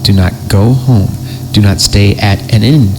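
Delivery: usual speaking voice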